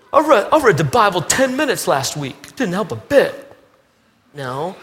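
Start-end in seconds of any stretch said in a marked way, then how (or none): 0.1-3.5 high, louder defensive whine; hammed-up self-justifying believer; mock whiny excuse. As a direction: protest like a sulky kid caught out. Whiny excuse answering God.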